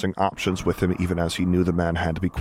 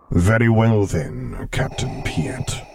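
dark lord; 3 semitones higher, much slower than usual. Acted. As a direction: let the words rumble out slowly. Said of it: "the man rumbled".

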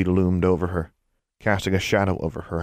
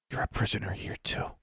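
soft, secretive near-whisper; hushed revelation; 3 semitones higher, 9 dB quieter than usual. A narrator's reading. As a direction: confide it so the listener must lean in. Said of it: "spoke in a whisper".

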